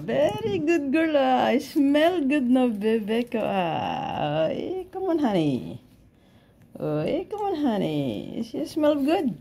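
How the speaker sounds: high, cooing pet talk. Real, not acted. Owner coos to her dog Lucky after drying her, high baby talk.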